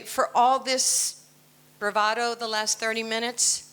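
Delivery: ordinary delivery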